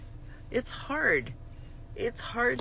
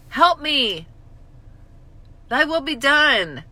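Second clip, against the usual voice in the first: pleading, higher and louder; mock desperate prayer; 6 semitones higher, 7 dB louder than usual. Mocking her own prayers to God, loud pleading. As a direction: beg God like you're at your wit's end. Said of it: "Those are my prayers".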